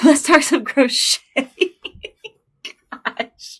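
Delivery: laughing relief after getting emotional; laughing through tears. Real, not acted. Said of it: "laughs after crying".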